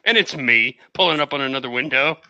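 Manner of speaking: normal talking voice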